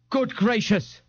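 high-pitched shocked splutter; pompous, high-voiced shock. A narrator's reading. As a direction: splutter in lofty dismay. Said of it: "Ignatius spluttered".

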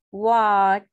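watch said loud and set apart; stress on watch. Shown as a demonstration. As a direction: punch watch without the ED. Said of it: "sin la E D". (watch fuerte y separado)

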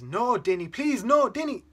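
high, very loud panicked cry; desperate pleading. Acted. Uncle begging Dinny to stop, terrified shouting. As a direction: beg for your life at a shout. Uncle begs during violent fight.

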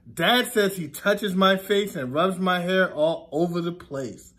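ordinary talk, nothing marked

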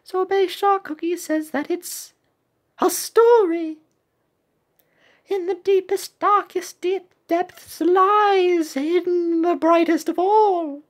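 high, dramatic recital; little cookie translating. Acted. Voices cookie translating symbols.

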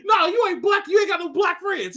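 high, loud mock yell; hammed-up angry shout.

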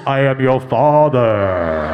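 low, slow, heavy villain impression; loud stage whisper, drawn out and deep; whispered movie villain. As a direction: intone it slowly, very low. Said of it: "she leans in and she whispers".

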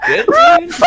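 squealed laugh. Real, not acted. Mock laugh voice.

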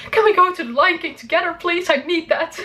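high, quick pleading request; pleading to mom. Pretend. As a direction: ask mom sweetly, half laughing. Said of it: "I'm just going to say".

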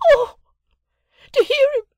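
very high, loud shriek; screamed cry. A narrator's reading. Sister appeals against the journeyman, screaming. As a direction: scream it in outrage. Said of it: "a clap of her hands and a scream".

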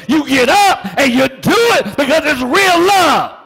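loud and high, full preaching fire; preaching shout. Real, not acted. Preacher shouting real love to the church. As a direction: lift it into a shout.

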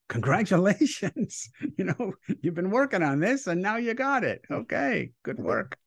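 pat them on the head with a high voice; mock congratulating voice. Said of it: "they go".

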